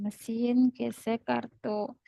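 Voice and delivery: slow, drawn-out words; slow dictation. Shown as a demonstration. Teacher giving students the term to write, slow dictation. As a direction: spell it out slowly for note-takers.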